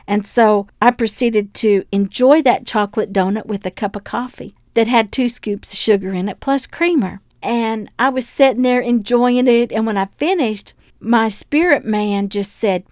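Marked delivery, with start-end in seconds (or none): none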